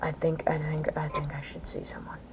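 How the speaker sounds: shaken, hushed whisper. Real, not acted. Gamer whispering he needs therapy after the level. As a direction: whisper it like you're rattled.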